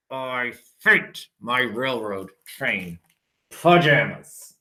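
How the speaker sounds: low, slow soft grumble; sulky child. Acted. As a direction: sulk over the pajamas. Child sulking over pajamas.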